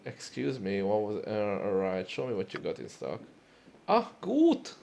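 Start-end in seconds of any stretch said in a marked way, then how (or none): 3.9-4.7 high, loud hammed-up German exclamation; a delighted German merchant; German accent. Reacting to the customer in a loud German accent. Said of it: "crank out my German accent".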